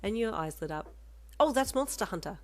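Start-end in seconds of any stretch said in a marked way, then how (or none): 1.4-2.4 loud, high burst of recognition; pleased recognition. A narrator's reading. Listener spotting a known game poster, pleased. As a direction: exclaim it with a smile. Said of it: "with a smile of recognition".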